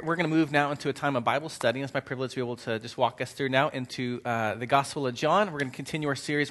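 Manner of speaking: ordinary talk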